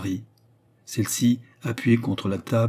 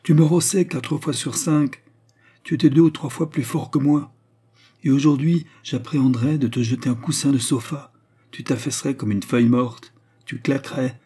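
louder, higher scornful taunting; voice rising higher; 5 semitones higher, 5 dB louder than usual. Acted. Georges taunts his weak brother, higher and louder. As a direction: raise the pitch and mock him hard. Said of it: "La voix de Georges s'éleva à un diapason supérieur".

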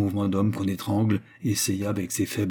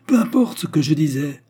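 loud, higher furious cut-off; fuming; 7 semitones higher, 6 dB louder than usual. Acted. Albert fumes at his wife, loud and angry. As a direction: fume and cut her off. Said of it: "fulmina-t-il".

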